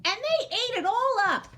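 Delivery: put-on Baby Bear complains her soup is gone, high and squeaky. A narrator's reading. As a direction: squeal it like a tiny upset cub. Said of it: "in her high squeaky voice".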